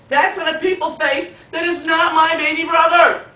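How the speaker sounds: loud, high-pitched indignant outburst; high, loud story-child indignation; outraged child's protest. A narrator's reading. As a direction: cry out in protest to Mom.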